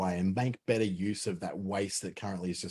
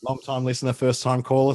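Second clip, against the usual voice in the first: upbeat, louder radio-show call-in; radio caller voice; 4 semitones higher, 8 dB louder than usual. Pretend. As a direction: greet it like a talkback caller. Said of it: "I feel like I'm on a radio station here".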